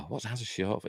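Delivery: ordinary talk, nothing marked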